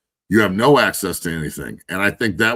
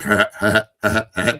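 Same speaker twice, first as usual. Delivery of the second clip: stuck stammering on the first sound; stutter. Retold or imitated. Shy recruit stammers a greeting, in a light mock stutter. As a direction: catch on "Ha" over and over. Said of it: "he had such a bad stutter".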